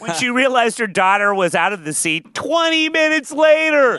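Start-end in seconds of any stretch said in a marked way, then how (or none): 2.3-4.0 loud, high repeated exclamation; belted out for comic outrage; incredulous emphasis. Real, not acted. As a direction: hammer it home in disbelief. Repeats the delay in disbelief.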